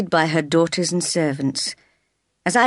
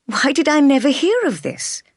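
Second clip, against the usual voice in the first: higher, astonished question; amazed; 8 semitones higher, 3 dB louder than usual. A narrator's reading. Jane hearing of her uncle's letter, amazed. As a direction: let the news catch you off guard. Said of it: "I asked, amazed".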